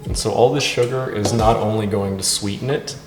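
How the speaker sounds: normal talking voice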